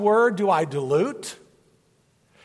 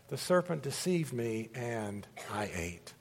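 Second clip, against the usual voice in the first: quiet confession; 8 semitones lower, 12 dB quieter than usual. A narrator's reading. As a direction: murmur it out, ashamed. Woman confessing to God.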